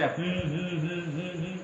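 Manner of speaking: drowsy, soft repeated hum; sleepy hums. Acted. Parvati sleepily humming along to Shiva's tale, soft drowsy hums. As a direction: murmur hum like someone nodding off. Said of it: "করেই যাচ্ছে".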